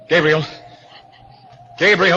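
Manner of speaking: loud, anxious call into the dark house. Acted. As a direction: call his name, worried and loud. Calling for the vanished butler.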